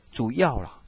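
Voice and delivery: ordinary delivery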